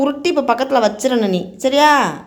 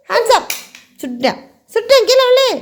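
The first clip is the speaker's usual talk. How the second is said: mock gunman; 8 semitones higher than usual. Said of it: "துப்பாக்கி சுடுறேன் நானுன்னு பாரு". (உயர்ந்த குரலில் விளையாட்டு மிரட்டல்)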